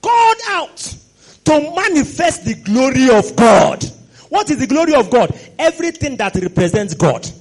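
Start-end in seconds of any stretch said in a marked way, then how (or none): 0.0-3.8 preached divine declaration; proclaiming God. Acted. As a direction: declare it like a herald from heaven. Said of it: "He said".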